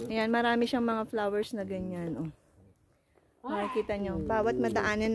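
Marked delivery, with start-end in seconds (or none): none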